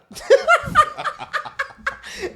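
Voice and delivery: cracking up at her own story; loud high burst of laughter. Real, not acted. Laughing at how the free gas story ended, real laughter.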